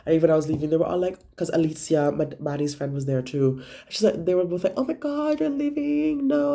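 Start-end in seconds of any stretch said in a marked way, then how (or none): 4.8-6.5 high, mock-dismayed protest. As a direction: play the friends' squealing disbelief. Friends protest her leaving early.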